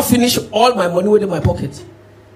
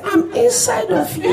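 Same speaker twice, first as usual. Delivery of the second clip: high, soft, slow demon taunt; 12 semitones higher, 4 dB quieter, slower than usual. Acted. Demon taunting the trekking comedian, high and soft. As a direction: tease him slowly in a thin high demon voice.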